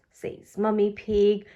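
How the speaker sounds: ordinary delivery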